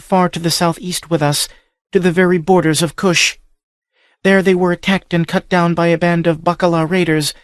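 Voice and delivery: put-on high, earnest captive girl; young woman voice. A narrator's reading. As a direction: speak as the frightened captive Livia. Livia's tale.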